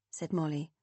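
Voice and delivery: plain reading voice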